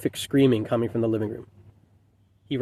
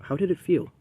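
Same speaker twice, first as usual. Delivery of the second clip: higher, tender waitress voice; tender concern; 6 semitones higher, 3 dB quieter than usual. A narrator's reading. Waitress leaning in to the staring father, tenderly concerned. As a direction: ask softly and high, with care. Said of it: "said with tender concern".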